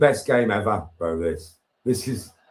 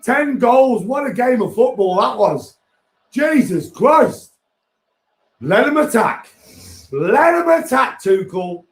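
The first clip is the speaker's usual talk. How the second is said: much higher and louder, euphoric; excited raving; 11 semitones higher, 5 dB louder, slower than usual. Real, not acted. Fan buzzing to his mates about the game, high and loud. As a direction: rave about the match, still buzzing.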